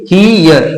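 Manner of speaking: हीयर as 'hee-yar', long first vowel; rule-based pronunciation. Shown as a demonstration. Teacher applies the E rule for students, demonstrated long-ee हीयर. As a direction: draw out the ee of हीयर before the yar. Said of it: "ई का उच्चारण ई ए हो जाएगा".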